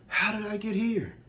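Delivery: a man asking aloud how he got to the front of the church, high and bewildered; panicked disbelief. Retold or imitated. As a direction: blurt it out in stunned panic. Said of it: "I start to panic".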